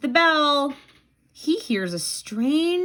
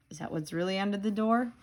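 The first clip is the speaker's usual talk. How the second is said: usual speaking voice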